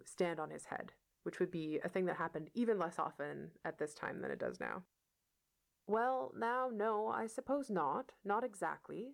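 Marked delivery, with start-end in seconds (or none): 5.8-9.1 put-on hesitant, halting, higher old farmer; stammering. A narrator's reading. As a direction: stumble through the words timidly. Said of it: "stammered Matthew".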